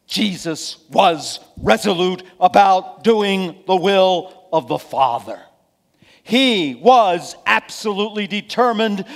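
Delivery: loud, high, emphatic sermon delivery; forceful preaching. Real, not acted. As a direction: drive the lesson home from the pulpit, slowly and loudly.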